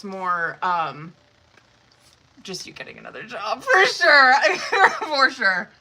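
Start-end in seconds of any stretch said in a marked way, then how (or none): 3.6-4.9 laughing through the words. Real, not acted. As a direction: laugh it out, then say it. Laughs at card reading.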